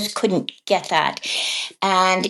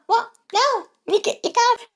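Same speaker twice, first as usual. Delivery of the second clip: high-pitched retold quote. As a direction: imitate it in a high, squeaky register. Retells child's reaction in cartoonish scene.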